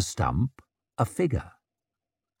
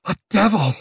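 high, louder cry of horror; alarmed cry; 9 semitones higher, 4 dB louder, faster than usual. A narrator's reading. Man amazed by the scarred witch, crying out in alarm.

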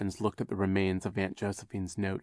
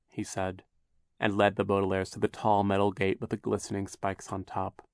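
plain reading voice

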